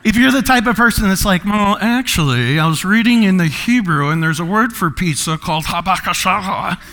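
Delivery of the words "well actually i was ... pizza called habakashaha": know-it-all teacher. Acted. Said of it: "You're a teacher".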